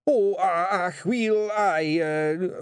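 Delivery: high, halting Scottish husband on the spot; flustered stalling. Acted. Rob stalls before his wife, flustered. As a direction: stammer for time, caught out. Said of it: "his face falling".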